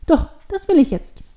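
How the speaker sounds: put-on high, loud, stubborn child; angry insistence. A narrator's reading. Devil girl refuses to be talked out of it, angry. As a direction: insist like a cross little girl. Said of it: "Wütend stampft sie".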